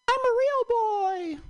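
put-on very high, slow puppet-boy voice; Pinocchio. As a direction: squeal it slowly like a little wooden puppet. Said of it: "I had to break it off with Pinocchio".

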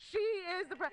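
ordinary talk, nothing marked

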